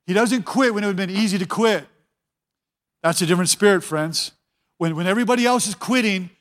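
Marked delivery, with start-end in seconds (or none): none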